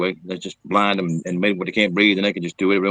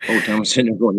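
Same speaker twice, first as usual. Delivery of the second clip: usual speaking voice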